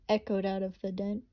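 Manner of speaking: ordinary delivery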